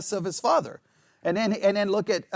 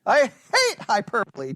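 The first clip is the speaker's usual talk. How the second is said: much higher, hammed-up mock denial; playful sarcastic exaggeration; 6 semitones higher than usual. Shown as a demonstration. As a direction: deny it ironically. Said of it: "to be sarcastic".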